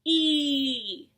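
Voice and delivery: E said long as the letter name. Shown as a demonstration. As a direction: stretch out E. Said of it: "the long sound".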